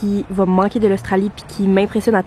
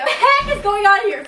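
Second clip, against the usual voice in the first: surprised; 11 semitones higher than usual. Real, not acted. Finds kids in bed.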